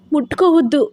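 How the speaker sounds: high sharp shout. Acted. Wife to her husband wiping her tears, shouting. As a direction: snap at him to back off. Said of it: "అరిచింది".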